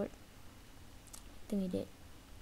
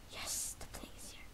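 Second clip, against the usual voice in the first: soft voiceless whisper; 15 dB quieter than usual. Real, not acted. Gamer finding the plane, whispering to viewers. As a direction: murmur it in secret relief. Sneaking in game.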